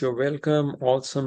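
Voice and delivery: ordinary talk, nothing marked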